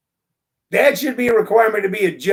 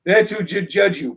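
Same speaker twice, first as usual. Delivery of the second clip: normal talking voice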